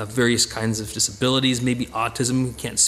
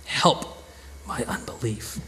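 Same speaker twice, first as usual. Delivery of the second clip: whispered plea; 4 semitones lower, 6 dB quieter, slower than usual. Acted. Father's desperate plea to Jesus.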